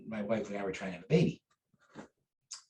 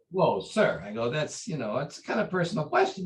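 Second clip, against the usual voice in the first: taken aback reply; 7 semitones higher, 4 dB louder than usual. Retold or imitated. Embarrassed reply to grandmaster.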